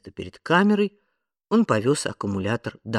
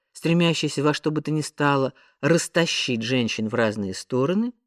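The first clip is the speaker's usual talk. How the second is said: ordinary delivery, nothing marked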